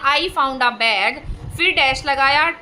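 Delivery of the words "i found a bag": slow dictation. Shown as a demonstration. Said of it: "मैंने sentence लिख दिया".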